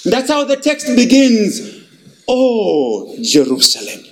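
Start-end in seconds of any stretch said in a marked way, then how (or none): 2.3-4.0 sighing lament, much lower; sighing sorrow. Acted. Preacher voicing Jesus' lament, low and sighing. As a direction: sigh it out in sorrow. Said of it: "exclamation mark of sighing, of sorrow".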